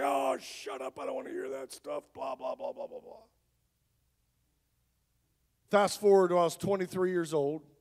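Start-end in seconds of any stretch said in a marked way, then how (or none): 0.0-3.2 scoffing shut up trailing into a dismissive blah blah; sarcastic dismissal fading to a sneer; mocking brush-off. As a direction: wave her off like nagging noise. Brushing off wife's prophecy.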